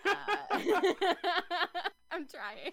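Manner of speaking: breaking up laughing; helpless high laugh, then an attempt to talk. Real, not acted. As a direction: try to answer through real laughter. Laughing while fumbling for the details.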